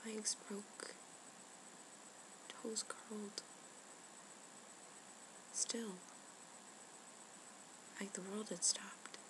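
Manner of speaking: soft, halting phrases with long pauses; stunned hush. Acted. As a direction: let each detail drop quietly into silence. Describes the dead birds, stunned.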